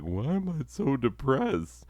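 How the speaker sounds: a lost, high whine of confusion; confused self-question. Retold or imitated. Depressed man asking himself, high and puzzled. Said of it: "And I'm like".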